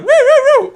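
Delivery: high loud wailing siren whoops; imitated siren, high and loud; car alarm. Shown as a demonstration. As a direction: wail like a car alarm going off. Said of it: "Car alarm".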